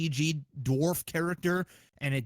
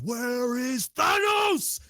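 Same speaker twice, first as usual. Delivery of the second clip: Thor's battle cry; 6 semitones higher, much slower than usual. Retold or imitated. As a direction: bellow it like a god arriving for war. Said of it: "he goes".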